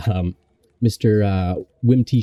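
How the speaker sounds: normal talking voice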